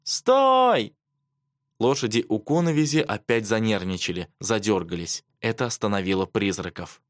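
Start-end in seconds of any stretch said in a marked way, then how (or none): 0.0-0.9 very high cry of command; high, desperate yell; shouting. A narrator's reading. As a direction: cry out to halt a horse. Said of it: "кричала она".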